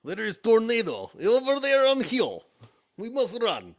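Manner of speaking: loud, high hammed-up Russian accent, warning of a tornado. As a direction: shout the warning like a panicked Russian. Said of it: "Russian accent. There's a tornado coming".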